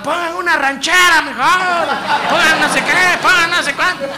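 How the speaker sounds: mock heckler shouting. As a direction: yell for a ranchera instead. Others shouting for rancheras.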